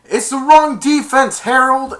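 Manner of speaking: high, loud warning shout; frantic yell. Retold or imitated. Kevin yelling to Harold about the defense, loud and high. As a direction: yell across the field in alarm. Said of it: "Kevin went".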